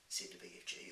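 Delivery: usual speaking voice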